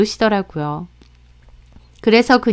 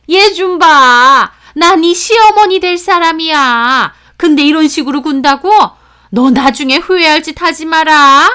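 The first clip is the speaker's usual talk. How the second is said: loud, high indignant taunting; sneering sarcasm; 7 semitones higher, 7 dB louder than usual. Acted. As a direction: threaten her as a scornful mother-in-law. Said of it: "그러면서 비아냥 거리시길래".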